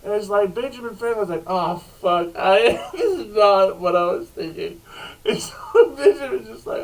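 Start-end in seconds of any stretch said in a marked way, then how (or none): 1.5-4.8 loud, breaking into laughter; laughing panic. Retold or imitated. Benjamin shot at, yelping through helpless laughter. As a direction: panic dissolving into giggles.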